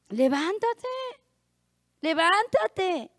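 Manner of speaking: lightly mimicked wake-up call, drawn out; stretched, sing-out imitation of a brother calling; imitated brother's call. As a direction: call it out long, imitating someone else. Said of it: "decía el hermano".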